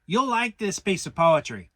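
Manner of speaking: Rabbit's voice. Acted. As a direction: press the point as Rabbit. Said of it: "said Rabbit".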